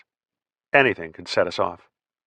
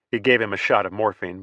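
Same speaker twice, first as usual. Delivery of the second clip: ordinary delivery, nothing marked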